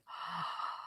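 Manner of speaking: sharp, breathless gasp of delight. Retold or imitated. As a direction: gasp as you slip into soft sheets. Feeling the soft sheets.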